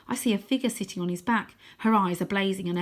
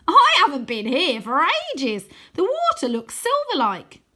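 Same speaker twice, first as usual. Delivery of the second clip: higher, louder and slow; happy boy marvelling; cheerful boy; 9 semitones higher, 8 dB louder than usual. Acted. Wynne delighted, flying.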